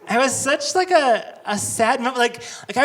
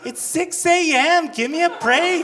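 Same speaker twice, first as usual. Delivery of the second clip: exasperated protest; 10 semitones higher, 4 dB louder than usual. Retold or imitated. Protesting instructor at dawn.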